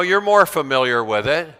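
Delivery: usual speaking voice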